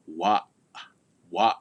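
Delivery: wa cut off in the throat; "wa" ending abruptly; glottal stop. Shown as a demonstration. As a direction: chop wa short with a glottal catch. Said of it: "Notice another glottal stop there".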